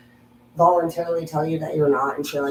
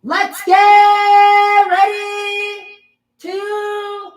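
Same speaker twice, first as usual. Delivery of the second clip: very high, stretched-out bellow; ring announcer; 9 dB louder, much slower than usual. Acted. Opening a wrestling match, drawn-out announcer's call. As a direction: sing it out long and loud to the arena.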